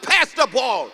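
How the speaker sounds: loud, forceful coach's order; retold yell. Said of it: "I yell at them".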